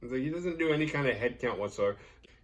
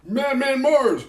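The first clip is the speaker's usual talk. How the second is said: high, loud, slow chanted yell; shouting Madman Mars over and over; yelling the name; 12 dB louder, slower than usual. Retold or imitated. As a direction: bellow it at the house, drawn out. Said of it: "just starts yelling".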